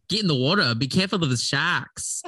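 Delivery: a light Aussie accent on the trigger phrase; Australian accent. Shown as a demonstration. Telling friends her acting-school trigger: light Australian accent. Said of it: "the Australian kinesthetic trigger was".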